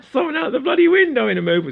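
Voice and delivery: high, squeaky laughing voice. Real, not acted. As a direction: talk through real laughter. Laughing at own acted heckler.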